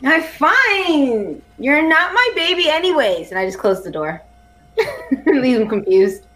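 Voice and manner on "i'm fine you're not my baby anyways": high, swooping, put-on pout; mock huffy. Player brushes off the doctor before closing the door, mock huffy. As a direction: act wounded and dismissive.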